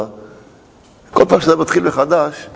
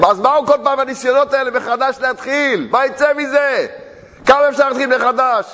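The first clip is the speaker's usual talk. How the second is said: much higher, impassioned sermon cry; preaching at full force; 9 semitones higher than usual. Acted. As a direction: rouse the crowd with fervour. Fiery preaching outburst. (דרשה נלהבת בקול גבוה ורם)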